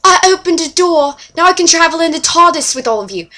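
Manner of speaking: high, loud mocking voice; mock Mickey's boast. Fan mocking Mickey's boast in a high, loud voice. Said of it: "But Mickey's like".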